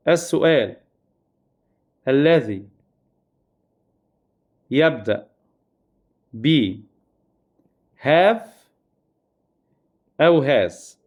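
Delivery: each word spaced out with long pauses; spaced-out teacher dictation; slow dictation. Shown as a demonstration. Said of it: "word by word dictation of rule".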